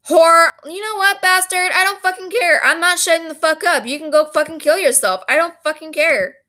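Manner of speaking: high-pitched, heated reading of an insult-filled message; angry tirade. Retold or imitated. Texter lashing out at the woman they blame, angry.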